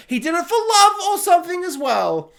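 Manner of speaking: high, hammed-up dismissal; sing-song high pitch, not taking it seriously; exaggerated high-pitched afterthought; mock-romantic outburst. Mock romantic explanation of plot.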